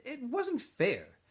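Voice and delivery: ordinary delivery, nothing marked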